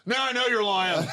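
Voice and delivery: high, loud mock scoff with a laugh; bartender scoffing. Bartender calling the comic a liar, loud mocking scoff. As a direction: sneer it like you caught him lying. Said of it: "the way he did it".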